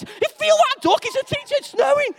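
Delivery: excited teachers. Retold or imitated. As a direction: shriek it like a thrilled teacher. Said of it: "the teachers are all excited".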